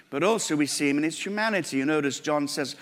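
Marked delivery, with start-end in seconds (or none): none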